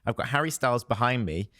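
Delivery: ordinary talk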